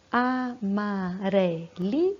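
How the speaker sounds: Amarelinha said slowly as a-ma-re-li-nha; teacher spelling out syllables slowly; split into syllables. Shown as a demonstration. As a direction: tap out every syllable of Amarelinha. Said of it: "a gente vai separar em sílabas".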